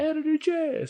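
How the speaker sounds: high, drawn-out hype call; DJ calling card. Retold or imitated. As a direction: sing out a name tag like a DJ drop. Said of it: "you can add a calling card".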